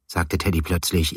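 ordinary delivery

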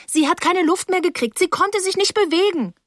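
high, urgent account; agitated girl trying to be believed; distressed insistence. Acted. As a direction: protest in a high, upset voice.

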